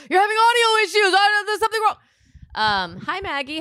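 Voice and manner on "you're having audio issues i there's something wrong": loud, high-pitched imitation of everyone panicking; mock panicked shouting. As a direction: yell it in a hammed-up fluster. Mocks everyone shouting over the caller.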